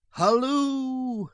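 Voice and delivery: high, loud halloo across the mountains; long quavering call. A narrator's reading. Sheriff hailing the outlaws across the mountains, long high call. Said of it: "He sent a long call quavering".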